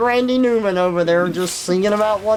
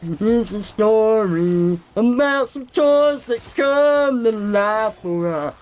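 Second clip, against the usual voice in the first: mock narrator; 3 semitones higher, slower than usual. Friend describing the toy story, slow and singsong. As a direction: tell it like a drawn-out blurb.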